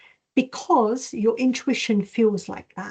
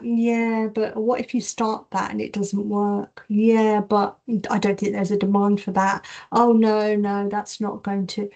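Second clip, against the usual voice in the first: self-doubt. Acted. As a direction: pour doubt on the idea, objection after objection. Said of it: "that's the self-doubt".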